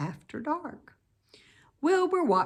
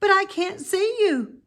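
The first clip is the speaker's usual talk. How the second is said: much higher, louder, anxious; startled piglet; 13 semitones higher, 7 dB louder than usual. Acted. Wilbur calling into the dark, high and anxious. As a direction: search the dark, alarmed. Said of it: "said Wilbur".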